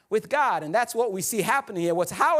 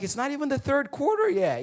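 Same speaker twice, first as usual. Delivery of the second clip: higher-pitched incredulous objection; lightly acted, high-pitched complaint; mock protest; 7 semitones higher than usual. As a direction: protest like a let-down friend, playfully. Acting protest at departing friend.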